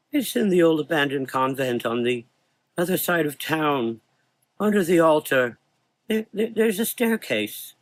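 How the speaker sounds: put-on high, stammering boy; frightened boy. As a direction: confess through fear. Dying boy confesses.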